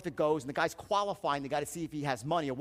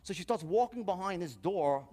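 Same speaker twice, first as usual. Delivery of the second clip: ordinary talk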